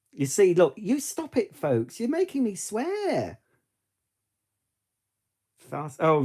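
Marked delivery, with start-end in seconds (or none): 0.1-3.4 mock-scolding. Mock complaint to folks.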